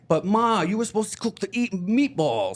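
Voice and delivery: put-on cheesy Italian mamma's boy whining in Italian accent. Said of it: "like, an Italian accent".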